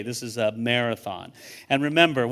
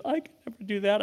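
lightly voiced daughter, pitched up high; imitated reluctant daughter; 8 semitones higher, faster than usual. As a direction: lift your voice to mimic her protest.

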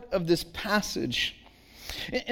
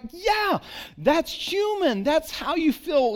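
sympathetic agreement; 8 semitones higher, slower than usual. Retold or imitated. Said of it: "You're like".

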